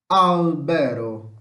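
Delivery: slow "Albero", strong on AL; AAL-be-ro, "Albero" drawn out for learners; first-syllable stress. Shown as a demonstration. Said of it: "Mais forte é a primeira sílaba". ("Albero" devagar, com a primeira sílaba forte)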